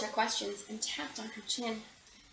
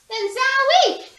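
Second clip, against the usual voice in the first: high, loud and slow zowie; shocked by the stench; startled zowie; 11 semitones higher, 10 dB louder, slower than usual. A narrator's reading. Stink strikes again.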